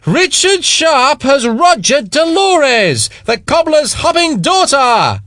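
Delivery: high, loud and slow proclamation; bellowing. A narrator's reading. Spiers bellowing the gossip across the plaza, loud and slow. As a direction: announce it to the whole square, drawn out. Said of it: "bellowed again".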